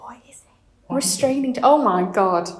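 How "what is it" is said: whisper. Real, not acted. Horrified at a torture chair.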